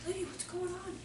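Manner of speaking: 声音明显放轻，柔和地问; 像蹲下来轻声安慰孩子那样问; 轻声温和地询问. Retold or imitated. Teacher gently asks crying child.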